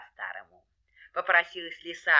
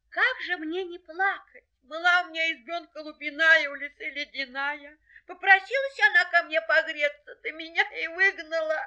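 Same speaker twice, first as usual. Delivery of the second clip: high, tearful hare voice; plaintive little hare; 9 semitones higher than usual. Acted. Hare tells rooster of losing his hut, tearful. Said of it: "идёт зайчик дорогой и плачет".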